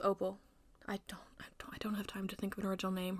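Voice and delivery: unvoiced aside whisper. Real, not acted. Teller whispering an aside to viewers about the queen's name. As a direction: drop to a whisper to confide.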